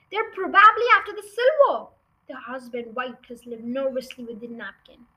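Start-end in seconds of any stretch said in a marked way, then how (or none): 0.1-1.9 high, loud alarm; urgent hiss. Acted. Mother fearing for the silver, urgent high alarm. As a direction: urge it out anxiously. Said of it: "hissed the mother".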